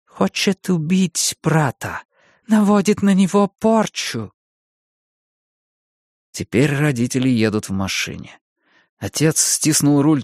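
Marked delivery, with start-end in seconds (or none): 0.0-4.3 frightened mother. A narrator's reading. Mother accuses the fetus.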